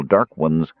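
usual speaking voice

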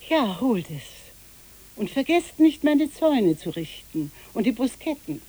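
put-on boat-hire woman calling orders after the kids. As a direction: shout the errands after them. Calling after children fetching the binoculars.

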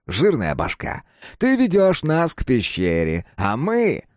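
put-on the dead boy taunting; creepy dead boy.

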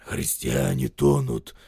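low, slow soldier's growl; low gruff grumble; hoarse grumbling soldier. A narrator's reading. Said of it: "ворчит солдат, ещё более охрипший".

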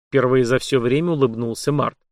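ordinary delivery, nothing marked

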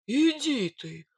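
astonished bogatyr exclamation; surprised. Acted. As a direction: draw it out in disbelief. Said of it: "удивился Илья".

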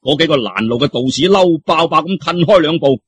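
usual speaking voice

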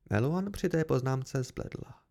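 ordinary delivery

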